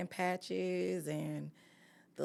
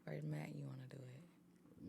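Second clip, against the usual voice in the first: whispering; 3 semitones lower, 16 dB quieter than usual. Real, not acted. Aside to Matt off mic.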